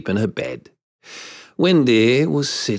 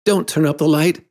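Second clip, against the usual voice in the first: high, urgent plea; boy's mildly fearful, raised voice; frightened cry; 7 semitones higher, 3 dB louder than usual. A narrator's reading. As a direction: cry out to stop it. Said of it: "he cried".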